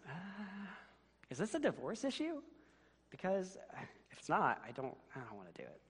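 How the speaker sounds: high put-on husband voice, stalling, then fading into a quick mumble; lazy husband. Husband stalls his wife about the dishes in a high put-on voice. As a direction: play the husband wriggling out of the dishes, pitched high.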